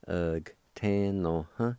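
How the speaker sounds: "Ug, tan, no, huh" in lightly separated syllables; careful teaching take of "Ug, tan, no, huh"; measured syllable demonstration. Shown as a demonstration. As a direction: say "Ug, tan, no, huh" with short gaps between syllables. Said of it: "pronounce the G sound with the V like this".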